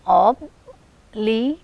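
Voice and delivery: "obligation" slowly split into ob, li, ga, tion; syllable by syllable. Shown as a demonstration. Teacher counting four syllables in obligation, slowly separated. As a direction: pause between each syllable of "obligation". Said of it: "You have four syllables here".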